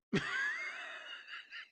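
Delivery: very high airy squeal of laughter; shrieking laugh. Real, not acted.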